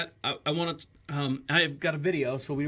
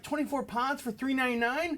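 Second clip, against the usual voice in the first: surprised; 7 semitones higher than usual. Retold or imitated. Disbelief at price.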